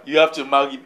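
lightly imitating the woman's demand; mocking her words. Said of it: "Listen, listen to her".

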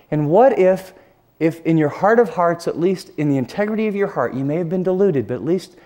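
ordinary talk, nothing marked

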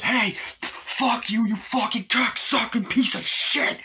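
high-pitched acted shout of abuse; drawn-out, hammed-up angry yell; enraged bully. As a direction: hurl insults like a furious bully. Bully swearing at the son.

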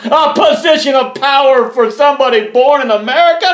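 loud scornful laugh through the words; a derisive laugh that breaks into the question; mocking laughter. Real, not acted. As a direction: laugh him off, loudly and high. Mocking the idea of power.

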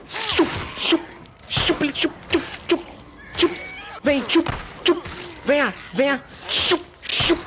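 high teasing kissy calls to a creature; mock luring call. Gamer teasingly calls zombies over like pets. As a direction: coax the monster like a stray cat.

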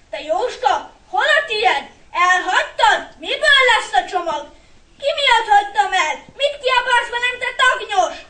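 loud, high accusations flung back and forth; heated, indignant yelling; shouted quarrel. A narrator's reading. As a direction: bicker furiously like two young brothers. Acted quarrel, one says "why shout.

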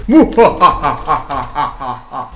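low villain laugh; an evil villain's cackle; evil chuckle. Acted. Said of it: "Essa risada é do mal".

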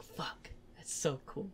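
slow, breathless whisper of awe; awed whisper. Retold or imitated. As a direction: breathe it out slowly, stunned. Awed reaction to favorite scene.

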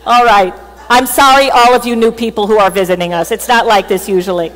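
ordinary talk, nothing marked

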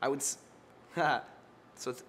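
usual speaking voice

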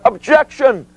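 high, drawn-out yell; yelled objection. Retold or imitated. Opposing counsel yelling again over the witness, high yell. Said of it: "he yells again".

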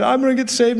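high, excited retelling of a convert; acted excitement. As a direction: imitate an eager new convert. Acting out man's sudden conversion.

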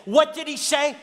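a pilot suddenly yelling at his seatmate. Retold or imitated. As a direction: bark the question out at him. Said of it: "And he yells at me".

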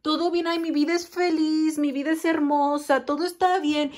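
high, sing-song mock brightness; fake cheerful denial. Reader mimics someone denying their problems, high fake cheer. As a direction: pretend everything is lovely. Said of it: "Lo ha negado".

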